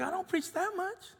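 tiny high defensive voice; squeaky protest. Retold or imitated. Dad answers daughter's charge, small high defensive protest. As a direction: protest softly in a very high pitch.

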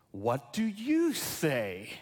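"What do you say?" drawn out slowly, voiced, asking each listener; slow earnest question. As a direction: lay the question gently on the room, word by word. Jesus' question posed to congregation.

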